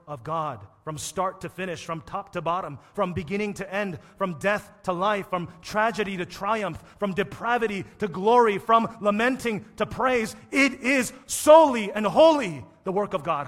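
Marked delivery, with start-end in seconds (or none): 4.1-13.5 building louder and higher; preaching crescendo. Acted. Preacher climbing to a loud cry for the church. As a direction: drive the list up to a shout. Sermon climax.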